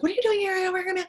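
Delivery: high, loud alarmed outburst; imitating shocked sister. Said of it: "She's like".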